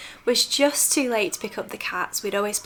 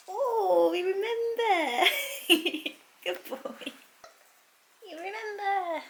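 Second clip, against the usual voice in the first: squeaky pet-talk greeting; high cooing pet voice; 8 semitones higher, 6 dB quieter than usual. Real, not acted. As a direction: coo at them like they're babies. Offering treats to the cats.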